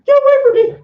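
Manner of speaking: very high, loud shrill shriek; panicked shriek. Retold or imitated. Fleeing fans at the con, a shrill panicked yelp. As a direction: yelp it like a cornered, panicked teen. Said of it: "and I'm just like".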